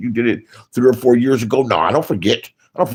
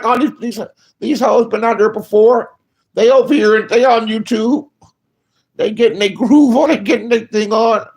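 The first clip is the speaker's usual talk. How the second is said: higher, louder mock rant about the hoes; mocking disbelief; 10 semitones higher, 3 dB louder than usual. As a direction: act out scoffing at the trolls, pitched up and loud. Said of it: "I'm like".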